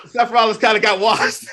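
cracking up mid-sentence; anger turning to laughter. Real, not acted. Laughing at the attack footage.

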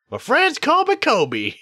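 high, slow kobold. Acted. Kobold introducing itself, high drawn-out voice. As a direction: draw the name out high as a kobold.

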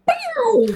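very high cartoonish "bow" yelp; imitated recoil noise. Showing how the bungee whipped her back, comic high yelp. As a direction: mimic being yanked back, way up high.

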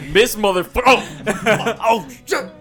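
high, loud taunt collapsing into laughter; hammed-up boast cut off by the blow; mock cocky taunt. Game master mimicking Levi as he gets stuck, mock cocky then shocked.

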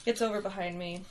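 ordinary talk

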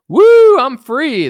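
very high, loud cheer; mock triumphant classroom outburst; jubilant whoop. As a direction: whoop like a kid breaking loose. Schoolkid standing up, whooping.

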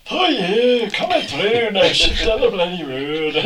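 high, loud Scottish comic impression; Billy Connolly. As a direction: holler it as a hammed-up Scottish comedian. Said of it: "you could have Billy Connolly talking".

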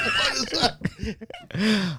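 laughing. Real, not acted. Laughing disbelief.

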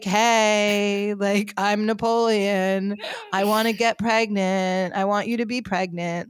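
slow, drawn-out, casual modern American voice; mock an actor making no attempt at an accent; lazy level delivery, vowels stretched out; Joaquin. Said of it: "he just is talking like he could have been in any modern day movie".

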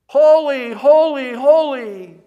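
ringing, high-pitched cry at full volume; loud, drawn-out proclamation thrown across to others; calling out. Acted. Seraphim calling out to one another, ringing high shout. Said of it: "they would call out".

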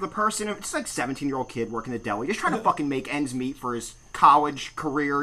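ordinary talk

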